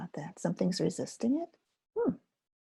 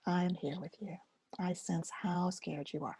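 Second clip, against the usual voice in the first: soft, gentle inner voice; kind reassurance; 8 dB quieter than usual. Shown as a demonstration. Teacher voicing what the hand says to scared places, softly. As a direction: comfort a scared part of yourself. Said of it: "you're saying".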